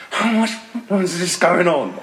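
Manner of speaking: high, pleading mock dismay; comic strained plea to the camera; mock desperate. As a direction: act struggling under a heavy load. Straining with heavy shaker, acted.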